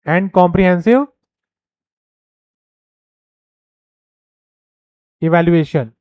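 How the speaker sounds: very slow, word by word; dictating to a class; slow dictation. Shown as a demonstration. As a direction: read the heading out slowly for notes. Spelling out a slide heading.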